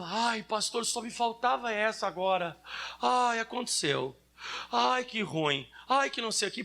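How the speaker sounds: whiny, drawn-out complaining; mock whining. Preacher mocking a whining believer, mock whine. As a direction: moan like a self-pitying believer. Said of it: "chorando e dizendo".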